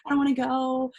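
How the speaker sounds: a hint of a whiny child; reluctant inner child. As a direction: plead like a child dragging their heels. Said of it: "the child in me".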